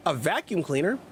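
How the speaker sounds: ordinary talk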